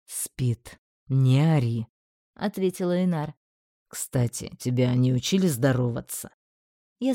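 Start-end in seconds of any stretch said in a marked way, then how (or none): 0.1-1.9 low, even voice, unbothered; calm low male voice. Acted. 3.9-6.3 low and dry, lightly needling; dry low male voice. Acted. Einar needles the magistrate.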